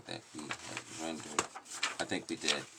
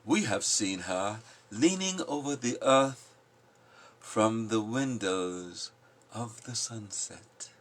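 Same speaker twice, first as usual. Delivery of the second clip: slow, measured poetry recital; poem reading; 5 semitones higher, 6 dB louder, slower than usual. Acted. Reading The Prophet aloud to the group, slow poetic recital. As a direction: lift each line like verse read aloud. Toiler and wayfarer speak.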